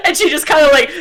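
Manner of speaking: ordinary delivery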